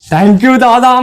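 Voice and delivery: usual speaking voice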